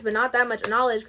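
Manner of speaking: usual speaking voice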